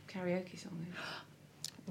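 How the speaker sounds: hushed whisper, slowed right down. Real, not acted. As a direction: whisper it as a quick aside. Aside to mum, whispering.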